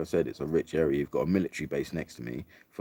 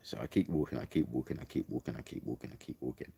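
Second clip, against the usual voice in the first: fading tension; 3 semitones lower, 8 dB quieter than usual. Retold or imitated. Telling listeners how she kept walking, fading to a whisper. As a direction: repeat it softer and softer until it's a whisper.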